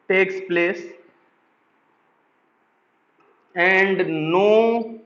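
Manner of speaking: very slow, word by word, with long pauses; spaced-out dictation read to a class. Acted. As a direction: dictate it so students can write each word. Dictating a statement slowly.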